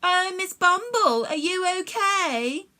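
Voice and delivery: slow, sing-song mock of a worried boy; mocking mimicry. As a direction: mimic him to make fun, drawn out. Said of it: "the meanies mimicked".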